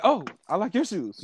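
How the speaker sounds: high-pitched retold flirt; flirting back. Man retells answering a pretty woman's compliment, flirting back. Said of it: "gotta flirt back".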